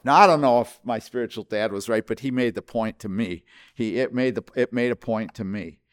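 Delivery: ordinary talk, nothing marked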